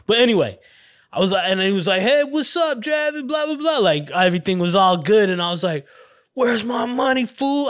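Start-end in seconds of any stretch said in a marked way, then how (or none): 2.0-3.8 high, put-on kid's hello; high-pitched mock chit-chat; imitated greeting. Retold friendly greeting, then filler. 6.3-7.7 high-pitched retold demand; imitated tough-kid challenge; mock confrontation. Retold demand for owed money.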